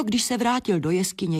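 usual speaking voice

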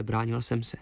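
ordinary delivery

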